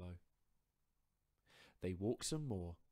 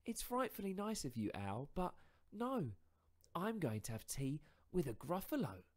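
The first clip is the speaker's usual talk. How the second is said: higher, louder mouse voice, spinning a tall tale; boastful little mouse; 10 semitones higher, 5 dB louder than usual. Acted. Mouse inventing a monster to frighten fox, higher and louder.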